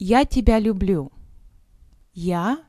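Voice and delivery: ordinary delivery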